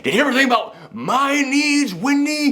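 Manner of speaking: high, strained mock rant; angry Jack Torrance. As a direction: whine it like a bitter husband. Jack ranting at Wendy, quoted.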